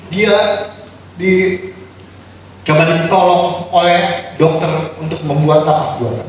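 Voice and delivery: normal talking voice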